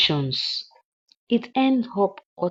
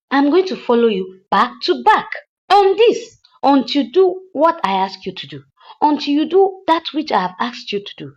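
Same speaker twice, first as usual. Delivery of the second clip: louder mock warning tirade; imitating angry threats; 2 semitones higher, 7 dB louder than usual. Madam Uchechi warning the hitman, louder mock threats. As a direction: threaten him, retold in a loud voice.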